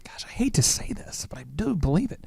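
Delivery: hushed mock-reluctant confession; confiding whisper. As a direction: drop to a whisper, as if loath to admit it. Mock-reluctant confession before self-praise.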